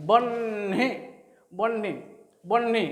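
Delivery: "বহ্নি" split in two, long n then hi; n before h. Shown as a demonstration. Teacher showing how it sounds, বহ্নি with n ahead of h. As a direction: say বহ্নি as "bonn-hi", n held. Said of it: "এইরকম উচ্চারণ হচ্ছে".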